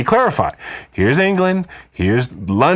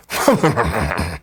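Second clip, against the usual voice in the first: short, snobbish British laugh; a lofty, self-satisfied chuckle; haughty lord's laugh; 4 semitones lower than usual. Shown as a demonstration. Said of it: "so that you sound like a British lord or baron".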